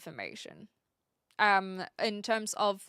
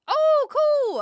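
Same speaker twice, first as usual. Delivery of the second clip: sudden loud, high burst of delight; genuinely thrilled by what she sees; 12 dB louder than usual. Real, not acted. Spots the links on screen.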